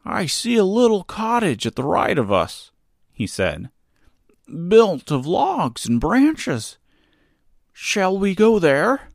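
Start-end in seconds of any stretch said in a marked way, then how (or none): 0.0-2.7 higher, odd scarecrow voice. Acted. 4.7-9.1 slow, higher scarecrow voice; drawn-out friendly scarecrow. Acted.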